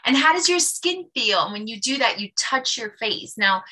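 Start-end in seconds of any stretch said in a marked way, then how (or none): 0.1-1.4 higher and louder, bright and inviting; cheery consultant question. Shown as a demonstration. Upbeat inviting consultant question. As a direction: consultant asks the client about her skin. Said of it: "you say".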